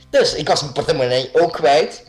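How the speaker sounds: normal talking voice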